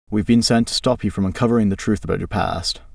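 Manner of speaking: ordinary delivery, nothing marked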